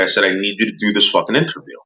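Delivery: usual speaking voice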